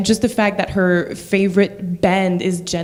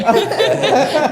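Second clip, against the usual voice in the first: high laugh; 8 semitones higher, 4 dB louder than usual. Real, not acted. Laugh about always Amanda.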